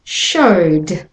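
Showed, O vowel into D; showed with a final d. Shown as a demonstration. Teacher models showed for learners. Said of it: "a word that goes from O to D".

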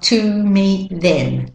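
"to" and "them" said slowly with full vowels; unreduced vowels. Shown as a demonstration. Teacher shows what to avoid, full vowels in "to" and "them". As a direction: overpronounce "to" as too and "them" with a clear E. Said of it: "notice I don't say them".

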